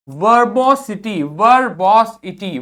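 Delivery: वर्बोसिटी said slowly; slow model pronunciation. Shown as a demonstration. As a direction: sound out वर्बोसिटी carefully. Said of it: "वहां S लिखते हैं". (धीमा उच्चारण)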